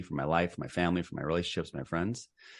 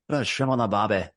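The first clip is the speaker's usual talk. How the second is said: playful mock Australian voice; Australian accent; 3 semitones higher than usual. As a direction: toss off a cheesy Australian accent for a laugh. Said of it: "how's the accent?".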